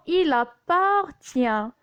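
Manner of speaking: "il appartient" slowly said as i-la-par-tient; linked and segmented. Shown as a demonstration. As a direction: carry the l into "appartient", syllable by syllable. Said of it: "on fait la liaison". (« il appartient » lié et découpé, i-la-par-tient)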